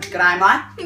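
put-on Australian accent. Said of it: "do Australian accents".

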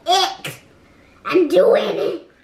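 loud, high, triumphant; excited shout. Real, not acted. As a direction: shout it proudly as you make it. Child excitedly succeeding.

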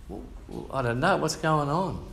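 normal talking voice

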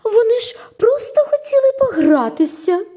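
high, loud regretful cartoon voice; high-pitched rueful musing; character thinking. A narrator's reading. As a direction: think aloud in a high, sighing voice. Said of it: "подумала вона".

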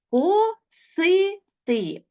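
косити stretched slowly as ко-си-ти; slow syllables. Shown as a demonstration. Teacher gives pupils an example, косити in slow syllables. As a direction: pull косити apart syllable by syllable. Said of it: "Наприклад".

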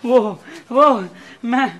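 halting, broken start; nervous stammer. Acted. Man caught leaving the police station, stammering nervously. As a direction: stumble over each word with pauses.

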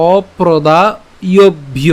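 অপ্রদায়ভ্য drawn out very slowly, syllable by syllable; slow pronunciation demo. Shown as a demonstration. Teacher slowly showing the class how অপ্রদায়ভ্য sounds. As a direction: stretch অপ্রদায়ভ্য out carefully. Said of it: "যদি উচ্চারণ করি".

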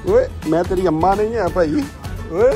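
loud, high playful scolding; mock protest. Mock protest to following calf. (ऊँची आवाज़ में मज़ाकिया डाँट)

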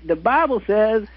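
slow, high Southern preacher parody; mock Southern accent. Shown as a demonstration. Guest showing the caller the line, exaggerated Southern accent. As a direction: stretch it out in a hammed-up Southern accent. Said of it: "with my terrible Southern accent".

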